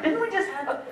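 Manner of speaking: lightly voiced puzzled crew member; mimicking confused crew. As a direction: play the crew hunting for a lost pen. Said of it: "they're like".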